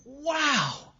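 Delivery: high, loud grinning revelation; elated amazement. Retold or imitated. Sponsee told he's made big progress, elated. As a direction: exclaim it with hammed-up delight. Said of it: "I got a grin from here to here".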